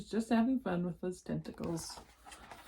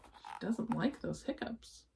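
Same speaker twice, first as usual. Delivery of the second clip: usual speaking voice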